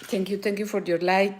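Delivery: usual speaking voice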